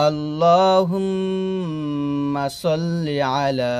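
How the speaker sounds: drawn-out chanted Arabic; measured, sustained teaching recitation; slow recitation. Acted. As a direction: chant slowly, humming the doubled m.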